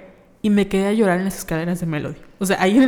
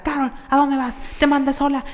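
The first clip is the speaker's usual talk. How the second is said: higher nagging mother calling out; mock scolding mom; 7 semitones higher than usual. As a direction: call after your daughter down the street. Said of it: "mi mamá me gritaba". (mamá regañando a Carol por la calle, imitada)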